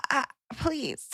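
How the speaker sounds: soft, weary mock plea; a deflated little please; pleading. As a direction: beg quietly, half giving up. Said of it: "And I just am like".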